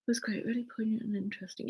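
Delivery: fast, breathy whispered words; breathless whisper. Real, not acted. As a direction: whisper it while still winded. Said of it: "so out of breath".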